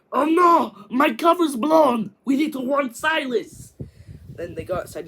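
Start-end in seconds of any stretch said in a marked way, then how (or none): 0.1-3.7 much higher and much louder panicked cry; panicked shout. Retold or imitated. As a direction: yell in alarm, the cover is blown. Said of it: "And then Gru's like".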